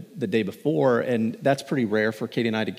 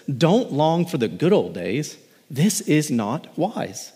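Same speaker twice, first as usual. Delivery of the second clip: slow, raised, each word weighed; slow emphatic reading; 5 semitones higher, much slower than usual. Acted. Preacher reading the verse to the church, slow and raised. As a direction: lean on every word, slowly.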